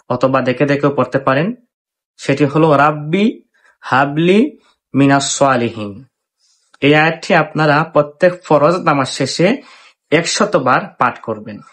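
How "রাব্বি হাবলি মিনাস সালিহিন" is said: slow, drawn-out Arabic verse recitation. Shown as a demonstration. Teacher reciting a verse for viewers to repeat, slow recitation. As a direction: recite slowly so listeners can follow along. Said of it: "আমার সঙ্গে পড়তে পারেন".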